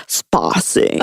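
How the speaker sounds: low, breathed catchphrase; Stefan from SNL. Acted. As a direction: imitate a sketch character's one-word catchphrase. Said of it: "Stefan S N L. He goes".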